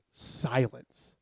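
ordinary delivery

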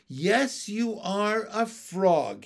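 carefully spaced-out answer. Acted. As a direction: pick each word slowly and carefully. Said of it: "I spoke carefully".

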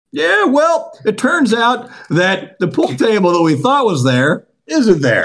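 high, slow, sing-song mock of the friends' excuse; mocking the friends. S0 mocking friends explaining the missing pool table, high sing-song. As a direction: ham up the friends breaking bad news. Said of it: "you guys are like".

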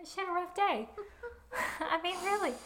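laughing through the words, up high; giggling while talking. Real, not acted. Laughing about the nap.

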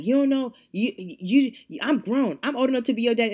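loud, high, stammering protest; heated, halting retort, retold; retold indignation. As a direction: mimic a flustered older customer pulling rank. Acted quarrel, customer pulls rank.

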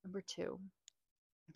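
hushed, voiceless confession; whisper. Pretend. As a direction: whisper it like a guilty secret. Whispered list continues.

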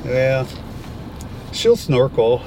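ordinary delivery

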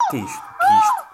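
high, stunned cry of disbelief; shocked disbelief. Real, not acted. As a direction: react as if you can't believe what you see. Espanto ao ver o vídeo. (espanto incrédulo, agudo)

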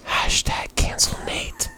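slow, conspiratorial whisper; mock whisper. Host starting a joke campaign against co-host, whispered. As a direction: whisper it like a secret slogan.

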